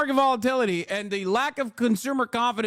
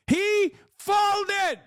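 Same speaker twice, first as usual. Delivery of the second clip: he folded, pitched way up; high shouted repeat; 10 semitones higher than usual. Real, not acted. Vlogger mocking Trump's reversal, high shout. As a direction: blurt it out in disbelief.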